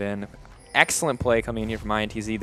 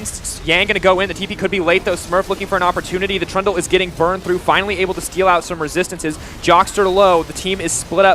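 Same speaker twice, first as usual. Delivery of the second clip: loud, higher fast casting; live play-by-play; 6 semitones higher, 5 dB louder than usual. Real, not acted. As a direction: call the fight at full tilt. Live play-by-play at full pitch.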